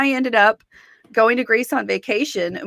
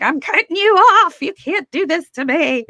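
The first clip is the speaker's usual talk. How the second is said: imitating mom, much higher and louder; upset mom; 9 semitones higher, 4 dB louder than usual. Quoting her mom's upset reaction, high and loud. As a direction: play the panicked mother cutting her off. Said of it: "my mom was freaking out".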